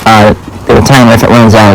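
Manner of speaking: ordinary talk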